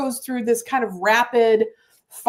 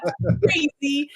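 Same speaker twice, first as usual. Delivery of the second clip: usual speaking voice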